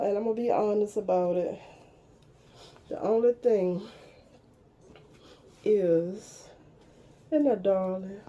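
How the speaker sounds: ordinary talk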